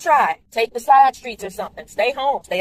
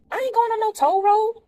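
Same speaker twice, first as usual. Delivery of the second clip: "I ain't going on no toll road" thrown out much higher; indignant refusal; 13 semitones higher than usual. Real, not acted. Driver refusing the GPS toll route: indignant exclamation, much higher. Reacting to GPS while driving.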